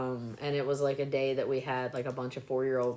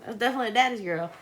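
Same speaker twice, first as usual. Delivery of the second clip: laughing; 9 semitones higher, faster than usual. Retold or imitated. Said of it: "I was like".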